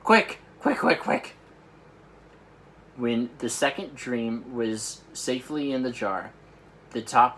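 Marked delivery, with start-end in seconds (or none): 0.0-1.3 high, loud urgent quick quick; urgent hurry. Acted. Giant urges Sophie to hurry, high and urgent. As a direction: rush her to hand over the jar.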